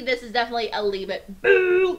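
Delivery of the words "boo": loud, high mock boo; boo noise. Real, not acted. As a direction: boo the candy loudly. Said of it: "make a boo noise for".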